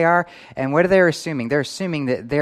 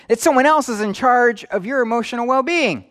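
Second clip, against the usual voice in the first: emphatic exclamation; 11 semitones higher, 4 dB louder than usual. Real, not acted. Preacher exclaims answer to own question.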